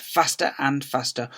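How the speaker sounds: usual speaking voice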